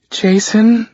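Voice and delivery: a lightly voiced mother sighing his name, high; a tearful mother's sigh on the name; mother answers the phone after crying, sighing. A narrator's reading. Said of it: "she sighs out".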